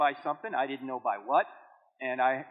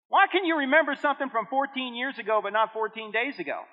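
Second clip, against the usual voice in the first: ordinary talk, nothing marked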